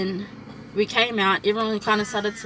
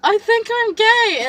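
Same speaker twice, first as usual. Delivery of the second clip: higher, louder and slow, half-crying; retold tearful confession; 12 semitones higher, 9 dB louder, slower than usual. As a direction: blurt it out through tears. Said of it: "started crying".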